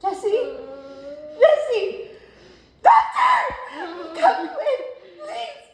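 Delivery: loud, high desperate calls for help; desperate cries. Acted. Girl begging the doctor to save her sister, desperate shouting. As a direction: scream for the doctor as Jessie dies. Peggy cries out for dying Jessie.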